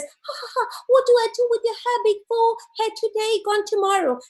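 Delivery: high cackling manicurist, fast; high laughing nail lady. Retold or imitated. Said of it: "The lady says".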